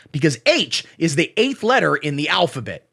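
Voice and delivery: ordinary talk, nothing marked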